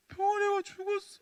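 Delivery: high, loud mock sobbing child; crying child. Young girl wailing that her chick died, high mock sob. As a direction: cry it like a little girl.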